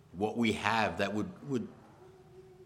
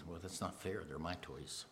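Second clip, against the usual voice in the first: put-on much softer, a little child's sulky whine; childish whine; 2 semitones lower, 14 dB quieter than usual. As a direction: sulk like a child guarding toys.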